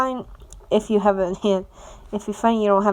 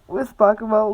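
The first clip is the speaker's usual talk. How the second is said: usual speaking voice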